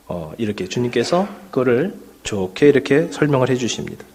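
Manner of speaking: normal talking voice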